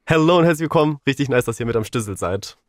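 ordinary talk

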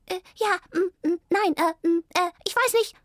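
high, panicky stammering squirrel. Acted. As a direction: squirrel frozen with fear, stammering. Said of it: "stammelte es".

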